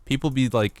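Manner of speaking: ordinary talk, nothing marked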